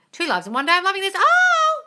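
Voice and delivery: high, loud excited squeal at the end; delighted. Real, not acted. Host thrilled by her second live today, squealing. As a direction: gush with glee, rising to a shriek.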